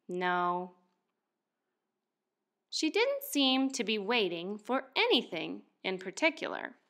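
ordinary delivery

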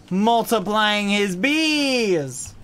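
loud, high, drawn-out "bees"; hammed-up loud exclamation; mock outcry. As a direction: build to a long wailed "bees".

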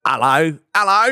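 high, loud hammed-up British Hello; thick British accent. Mocking how thick British accents sound. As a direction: overdo a thick British greeting. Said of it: "the accent's not as thick as".

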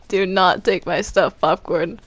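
usual speaking voice

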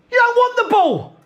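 yelled way up high at the keeper; high angry shout. Real, not acted. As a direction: scream it across the dressing room. Still yelling at keeper.

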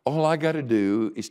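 a sudden dawning, voice up and loud; surprised realization. Retold or imitated. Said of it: "I went".

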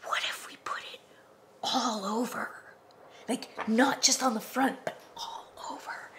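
hushed conspiratorial whisper; lightly voiced kid scheming quietly; whispered child idea. Sally whispering her clip art plan to Bobby. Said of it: "Sally turns to Bobby and goes".

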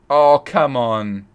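long, loud drawn-out complaint; slow exasperated oh come on; exasperated groan. Real, not acted. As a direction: stretch it out in exasperation. Frustrated with the game map.

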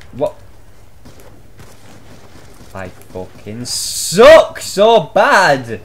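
ordinary talk

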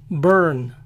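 "burn" as "brrn", no U sound; American "burn", held on the R; English vowelless R. Shown as a demonstration. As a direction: say "burn" as "bern". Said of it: "No se pronuncia la vocal U".

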